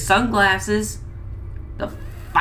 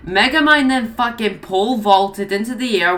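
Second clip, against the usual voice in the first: ordinary talk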